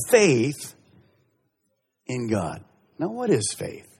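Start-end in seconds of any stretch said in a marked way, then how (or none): none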